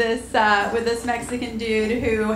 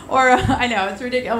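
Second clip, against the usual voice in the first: usual speaking voice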